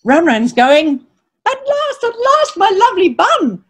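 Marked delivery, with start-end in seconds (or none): 1.5-3.6 high-pitched, delighted rat voice; thrilled, high and yearning; cry of pleasure. A narrator's reading. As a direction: exult as a little rat reaching its treat. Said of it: "he cried in pleasure".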